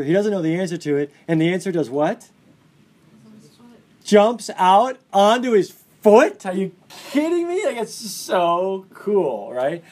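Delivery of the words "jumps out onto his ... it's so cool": loud, high burst of delight, drawn out; giddy teacher thrilled by the twist; excited amazement. Real, not acted. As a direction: marvel at it, stretching the words slowly.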